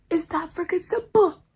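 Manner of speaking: high, emphatic punchline. Real, not acted. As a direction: hit it with sass.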